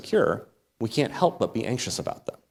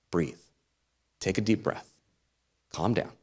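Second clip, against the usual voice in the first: soothing calm; 8 dB quieter than usual. Acted. Soothing paraphrase of fear not.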